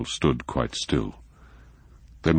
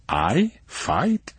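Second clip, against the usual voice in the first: high, incredulous question; disbelieving echo; astonished; 8 semitones higher than usual. A narrator's reading.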